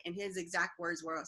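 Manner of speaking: ordinary talk, nothing marked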